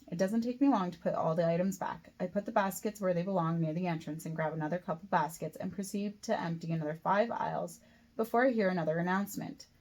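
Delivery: plain reading voice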